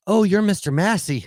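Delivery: lightly acted high-pitched recognition; clerk's recognition. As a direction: imitate a clerk suddenly placing the name. Retold front-desk clerk recognising guest.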